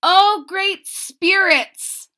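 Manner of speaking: high, slow, loud cry to the spirits; pleading prayer. Acted. Lonely girl calls to the great spirits, pleading. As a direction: call out to the spirits, begging. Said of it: "she who is alone said".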